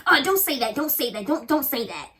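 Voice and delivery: imitating a flustered, defensive coach. Coach shuts down the complaining girl, defensive. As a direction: cut her off with a protesting "oh", then repeat. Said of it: "immediately she gets defensive".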